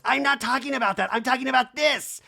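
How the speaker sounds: high-pitched, hammed-up exasperation; mock indignant retort. Kyle glaring at Bose, mock indignant. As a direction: imitate a defensive housewife snapping back. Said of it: "and she glares at Bose".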